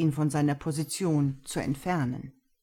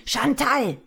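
loud shout, high and forceful; bellowing a name; 8 semitones higher, 6 dB louder than usual. Acted. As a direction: bellow the name across the office. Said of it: "brüllte er".

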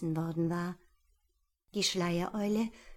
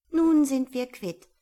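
higher, louder owl voice; owl parting; 6 semitones higher, 9 dB louder than usual. Acted. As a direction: settle the debt. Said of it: "sagte die Schleiereule".

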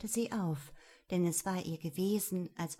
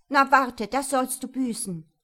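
loud, high threat; furious, raised voice; angry shout; 4 semitones higher, 11 dB louder than usual. A narrator's reading. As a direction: shout it in a temper. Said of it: "die so schrie".

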